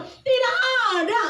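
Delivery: high, acted shout of denial; imitated child's holler; children calling out. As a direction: call up to a friend in a high voice. Friends call back to child.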